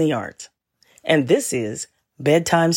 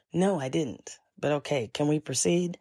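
muttered aside; 2 semitones lower, 8 dB quieter than usual. Retold or imitated. Muttered retort to agent.

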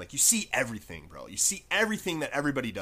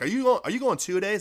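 retold anxious question; 9 semitones higher, 7 dB louder than usual.

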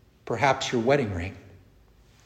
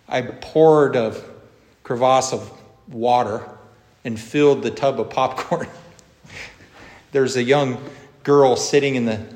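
ordinary delivery

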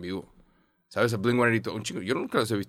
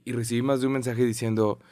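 normal talking voice

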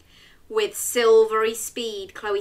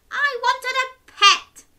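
sighing witch; 7 dB louder than usual. Acted. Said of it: "sighed the witch".

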